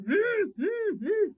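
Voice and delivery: loud, high wordless giant grumbles; annoyed giant's grunts. Acted. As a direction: grumble crossly without any words. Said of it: "was quite annoyed".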